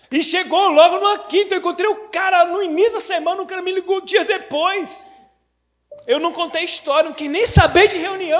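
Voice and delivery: high, loud sermon telling; fiery preaching. Acted. Pastor stirring the congregation with loud, fervent preaching. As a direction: preach it with rising fervour.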